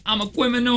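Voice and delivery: kwiminal with W for R; R-lisp criminal. Shown as a demonstration. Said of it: "if all of his R's were W's".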